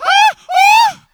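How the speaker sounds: two short, very high, loud screams; girlish mock scream. Speaker re-enacts his scream at the spider, girlish shriek. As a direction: scream like a girl. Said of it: "So I'm screaming. I'm like".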